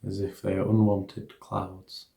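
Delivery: soft, hushed meditation guidance; guided meditation. Acted. Teacher guiding listeners through an equanimity meditation, soft hushed guidance.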